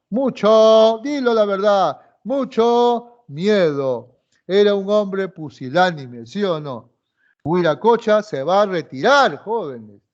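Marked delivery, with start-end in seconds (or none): 0.1-4.2 mucho miedo stretched out slowly; slow prompting stress. Shown as a demonstration. Teacher coaxing the answer: mucho miedo said very slowly. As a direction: drag out mucho miedo so the class fills it in. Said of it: "¿qué cosa tenía?".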